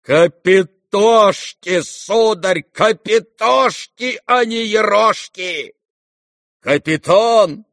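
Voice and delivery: put-on high, loud old general's tirade, words drawn out; heated shouting. A narrator's reading. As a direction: bellow it in a fit of excitement. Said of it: "Генерал кричал в азарте". (генерал кричит в азарте)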